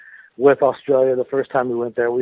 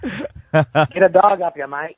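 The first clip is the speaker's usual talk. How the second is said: slow, drawn-out outback Australian, higher; Australian accent; 5 semitones higher, much slower than usual. Shown as a demonstration. Showing off his outback Australian accent to the host, hammed-up Australian. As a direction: stretch it out like a bush Australian. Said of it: "Can you do an Australian accent?".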